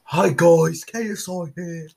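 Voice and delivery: lightly faked British streamer hello. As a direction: ham up a British YouTuber intro. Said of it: "He's British".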